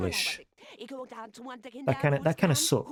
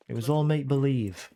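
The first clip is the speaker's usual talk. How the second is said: ordinary talk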